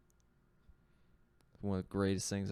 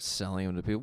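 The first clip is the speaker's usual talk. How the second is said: ordinary talk, nothing marked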